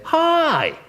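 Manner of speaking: very high, loud, cheerful hi; a sunny, welcoming hello; bright high hi. Shown as a demonstration. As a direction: greet them with a big lifted hi. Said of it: "that's not the same as".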